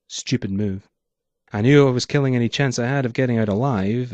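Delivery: ordinary delivery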